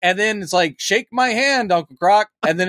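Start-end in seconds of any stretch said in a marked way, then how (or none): 0.8-2.3 higher, hammed-up cowboy robot; John Wayne impression. Android greeting Uncle Croc in mock John Wayne voice. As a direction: play the broken android greeting the host. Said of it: "really cartoonish John Wayne impression".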